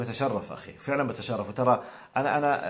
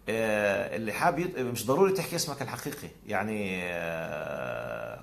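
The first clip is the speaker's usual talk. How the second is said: normal talking voice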